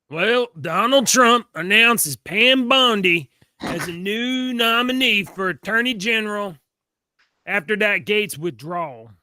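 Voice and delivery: slow, loud mock Texas drawl; Texan drawl attempt. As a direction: stretch it out lazy like a Texan. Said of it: "Is that better?".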